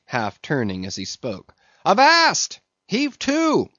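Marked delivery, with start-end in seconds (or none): 1.8-3.7 loud, very high sailor's hail; shouted command. A narrator's reading. Mate stopping the cook leaving, shouted command. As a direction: bellow a high halt order. Stubb halts the departing cook.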